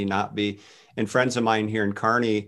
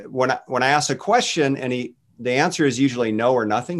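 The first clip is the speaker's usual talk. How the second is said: ordinary delivery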